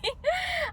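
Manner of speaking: high bright laugh at her own story; real amused laugh; burst of laughter. Real, not acted.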